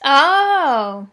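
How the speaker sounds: ordinary delivery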